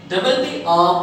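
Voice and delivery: ordinary talk, nothing marked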